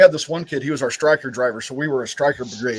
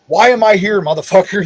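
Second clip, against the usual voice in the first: much louder and higher, fed up; exasperated outburst; 6 semitones higher, 7 dB louder than usual. Real, not acted. Vet venting about being punished for others, exasperated shout. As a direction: throw the question out in disbelief.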